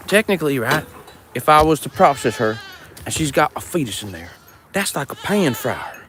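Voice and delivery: usual speaking voice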